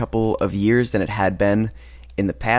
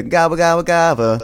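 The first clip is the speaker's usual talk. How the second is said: higher, louder gobbling impression; turkey gobble; 8 semitones higher, 5 dB louder than usual. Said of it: "It's totally her being a turkey".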